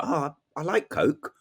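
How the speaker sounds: usual speaking voice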